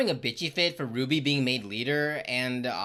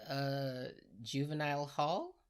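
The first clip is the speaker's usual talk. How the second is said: timid mumble; 10 dB quieter, slower than usual. Acted. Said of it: "Ruby answers the faculty".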